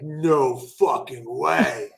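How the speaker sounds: slow, drawn-out no fucking way; stunned disbelief. Retold or imitated. Telling hosts of the Hulk Hogan email, slow stunned disbelief. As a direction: let it hang in slow disbelief. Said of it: "I'm like".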